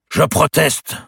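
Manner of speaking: high, loud ringing objection; powerful protest. A narrator's reading. Grand master interrupts the verdict from the steps, powerful protest. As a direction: proclaim it forcefully to the whole square. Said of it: "cette voix était si puissante".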